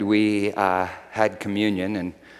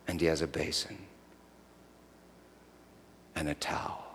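guided meditation; 4 dB quieter than usual. Acted. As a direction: lead them quietly into the picture. Leads the congregation in imagining.

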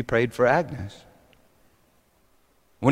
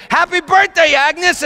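high, loud party shout; screamed greeting; 5 dB louder than usual. Acted. Party guests greet Agnes as she arrives, screaming. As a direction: shout it like a whole crowd at once. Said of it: "They all screamed".

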